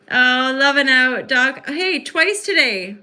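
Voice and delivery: high, loud, slowed delight; excited welcome. Real, not acted. Welcoming Doc back to the stream, she sounds high, loud and delighted. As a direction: stretch out a gleeful hello. Greeting returning viewer Doc.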